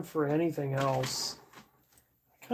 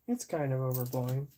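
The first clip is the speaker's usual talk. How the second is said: softer repeat, under the breath; quiet mutter; 3 semitones lower, 7 dB quieter than usual. Real, not acted. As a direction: mutter it again to yourself.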